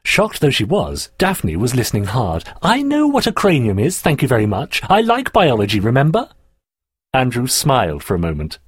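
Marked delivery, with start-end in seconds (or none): 2.6-6.3 high, emphatic retort; indignant. Acted. Daphne objects to Andrew explaining a word, indignant. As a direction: put him down huffily. Girl indignant at being talked down to.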